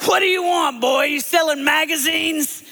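loud, high Tennessee holler; trashy Tennessee accent. Acted. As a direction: holler it like a rough Tennessee father. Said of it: "they talk like that in the trashy parts of Tennessee".

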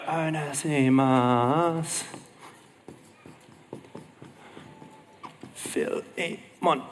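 very slow, syllable by syllable; drawn-out names. Real, not acted. Preacher writing names on the whiteboard, sounding them out slowly. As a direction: stretch each name out as if spelling it.

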